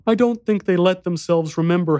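put-on high, serious child voice; solemn young boy. A narrator's reading. Boy explaining the diners to narrator, solemn child voice. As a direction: explain it as a grave little boy.

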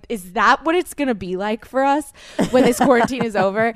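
a mock-wondering question broken up by laughter; laughing disbelief. Friend to co-host, imagining life after lockdown, laughing. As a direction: laugh through the question like you can't believe it.

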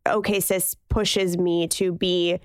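usual speaking voice

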